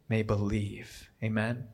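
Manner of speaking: ordinary talk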